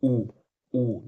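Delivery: rounded ऊ held; vowel demo. Shown as a demonstration. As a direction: round the lips and sound ऊ. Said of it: "जैसे आप बोलिए". (होंठ गोल करके ऊ बोलें)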